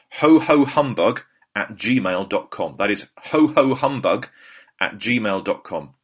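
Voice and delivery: slow, each word spelled out; slow clear address. Real, not acted. Telling listeners where to email, slowly.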